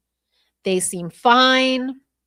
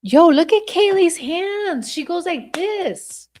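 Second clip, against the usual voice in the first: high-pitched, urgent exclamation; excited discovery; 10 semitones higher, 5 dB louder than usual. Real, not acted. Streamer pointing out Kaylee's hands to viewers, excited. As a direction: call everyone's attention to it, pitch jumping up.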